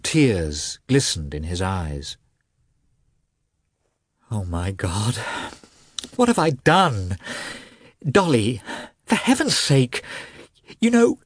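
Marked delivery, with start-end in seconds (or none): 4.3-11.2 broken, high-pitched pleading in bursts; choked with sobs. A narrator's reading. Husband begs wife, throat choked with sobs. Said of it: "His throat was choked with sobs".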